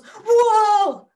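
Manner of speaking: loud, high startled "whoa". Retold or imitated. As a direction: yelp a drawn-out "whoa" in mock alarm. Retold shock as truck passes.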